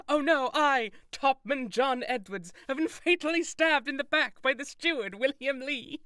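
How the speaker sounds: high, loud hammed-up death announcement; over-the-top dying cry, played for laughs; mock dying shout. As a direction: proclaim your own murder in mock agony, breaking into laughter. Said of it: "make sure to just shout".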